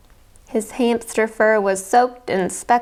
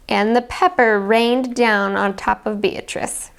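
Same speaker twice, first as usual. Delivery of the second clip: ordinary delivery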